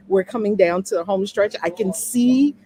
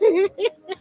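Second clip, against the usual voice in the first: high bursting giggle; bright excited laugh; 12 semitones higher, 5 dB louder than usual. Real, not acted. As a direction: let a delighted laugh burst out. Excited about raw unedited life.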